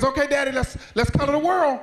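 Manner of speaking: put-on high, excited little-kid voice; eager child. Son comes running back to Daddy, excited.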